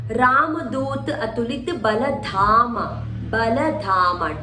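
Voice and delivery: spaced out slowly, dh in "Dhama" pressed; slow demonstration. Shown as a demonstration. Teacher reading slowly so students mark dh. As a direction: read the line slowly for marking. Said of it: "D H".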